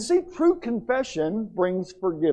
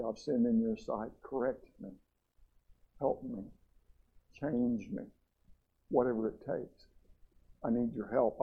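much softer, lower, broken earnest prayer; quiet heartfelt plea; 4 semitones lower, 7 dB quieter than usual. Shown as a demonstration. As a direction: whisper your confession to God. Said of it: "It was".

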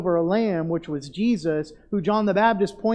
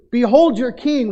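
mock proclamation; 5 semitones higher, 7 dB louder than usual. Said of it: "their anger really just sort of boiled up".

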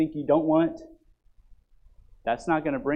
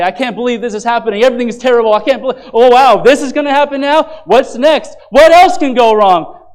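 loud, higher, mock-frantic griping; exasperated complaint; 9 semitones higher, 15 dB louder than usual. Pastor mimicking a believer caught in a storm, hammed-up exasperation. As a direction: ham up a fed-up whiner. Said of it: "you say".

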